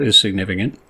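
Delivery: ordinary talk, nothing marked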